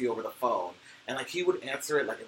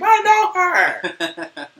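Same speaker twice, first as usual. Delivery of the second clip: much higher and louder, laughing shriek; excited scream; 15 dB louder, much slower than usual. Pretend. Fan recognizing friend's cartoon voice, laughing excited scream. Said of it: "and then I'd scream".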